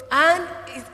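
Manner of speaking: ordinary delivery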